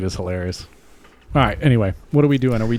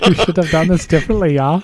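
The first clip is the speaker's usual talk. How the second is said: put-on high German-accented scolding; mock-stern German accent; 7 semitones higher than usual.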